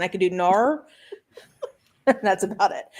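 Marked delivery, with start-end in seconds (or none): 0.3-0.9 a one-word Australian accent attempt, lightly done. Shown as a demonstration. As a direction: say it like an Aussie, barely. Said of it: "I cannot do an Australian accent".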